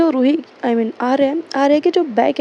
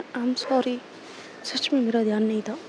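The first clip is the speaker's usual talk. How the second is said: apologetic; 2 semitones lower, 9 dB quieter than usual. Retold or imitated. Apologising after being scolded.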